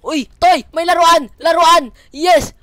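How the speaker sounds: high, loud celebrating; short bursts of loud glee; excited cheer. Real, not acted. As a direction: shout out the happy find. Delighted at finding toys.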